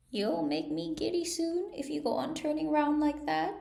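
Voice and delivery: put-on a fussy old sheep peering over spectacles; old sheep shopkeeper. A narrator's reading. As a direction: sheep shopkeeper lecturing Alice, lightly voiced.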